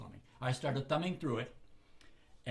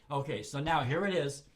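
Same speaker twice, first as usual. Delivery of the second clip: usual speaking voice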